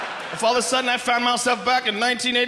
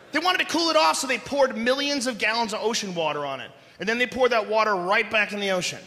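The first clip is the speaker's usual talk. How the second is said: ordinary talk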